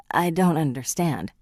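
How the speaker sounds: usual speaking voice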